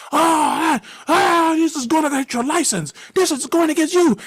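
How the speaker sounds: high, loud threatening yell; furious shout. Retold or imitated. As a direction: threaten him with his license. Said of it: "the dude is mad as a motherfucker.com".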